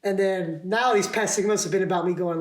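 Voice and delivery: ordinary talk, nothing marked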